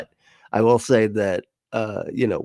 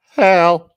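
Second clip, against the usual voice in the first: "Hell" called out loud and high; imitated inflection; 8 semitones higher, 10 dB louder than usual. Host showing Al how he said it, loud exaggerated "Hell". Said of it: "That was your inflection".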